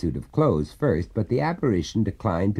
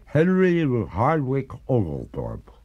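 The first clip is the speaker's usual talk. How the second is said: slow, gurgling ghostly voice; 5 semitones higher, slower than usual. A narrator's reading. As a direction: say it slowly as if through water. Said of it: "said the ghost in a gurgling voice".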